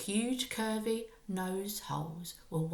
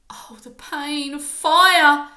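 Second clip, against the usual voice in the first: cry of pain; 6 semitones higher, 14 dB louder, slower than usual. A narrator's reading. Boy burning from the potion.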